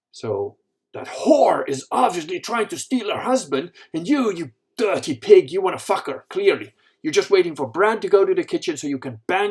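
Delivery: loud, high-pitched outraged accusation; jealous wife's rant. Acted. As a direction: rant as the wronged wife, hitting key words hard. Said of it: "what's going on in her head".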